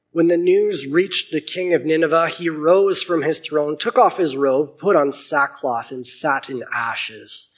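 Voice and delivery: steady Bible reading voice; clear, measured scripture reading. A narrator's reading. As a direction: read the passage out to the congregation. Reads Book of Jonah aloud.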